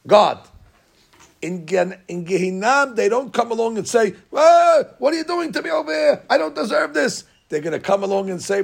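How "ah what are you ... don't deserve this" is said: indignant protest. Acted. Sinner in Gehinam protesting to God, high and outraged. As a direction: cry out that it is unfair.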